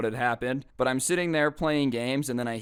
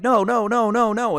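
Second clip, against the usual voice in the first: mock protest; 8 semitones higher, 6 dB louder than usual. Refusing friends' urging to flip.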